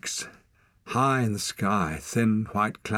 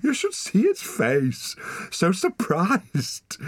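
laughing; 11 semitones higher, slower than usual. Acted. Both laughing at lemming.

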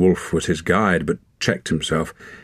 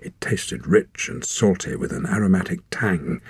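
ordinary delivery, nothing marked

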